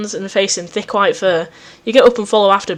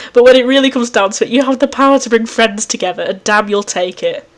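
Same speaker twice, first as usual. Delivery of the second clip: usual speaking voice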